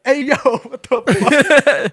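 loud, high, drawn-out retold yell; laughing mock-shocked shout; shocked outburst. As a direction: holler it in disbelief, breaking into laughter. Retelling shocked outburst at the slap.

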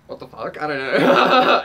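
laughing bewildered. Real, not acted. Reader laughing at her own creepy pile, laughing. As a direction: laugh through the confusion.

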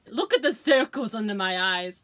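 ordinary delivery

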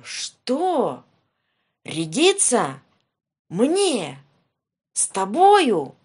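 loud, outraged, slowing at the end; angry shout. Acted. Tsar outraged that Ivan argues, angry shout. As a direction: roar with offended pride. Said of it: "закричал он".

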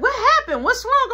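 high, loud urgent questions; alarmed mother. Acted. Mama seeing child choking, high alarmed voice. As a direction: ask urgently, pitched well up.

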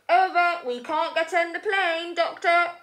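high, loud radio report; urgent pilot. Acted. Luther telling the doctor they can't reach the plane, high and urgent.

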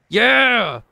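high, loud yeah; triumphant cheer. Real, not acted. Gamer cheering a second landed trick, triumphant shout. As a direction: cheer it out in one falling burst.

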